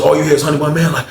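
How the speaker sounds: ordinary talk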